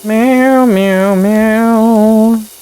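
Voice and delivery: cat meows. Pretend. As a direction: cry like a kitten stuck up high.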